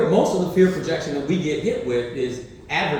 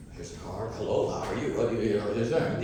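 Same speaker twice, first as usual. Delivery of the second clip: much lower, softer mock networker greeting; schmoozing networkers; 7 semitones lower, 7 dB quieter than usual. Guests after the ceremony handing out cards, low mock schmoozer. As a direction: play a slick glad-handing guest.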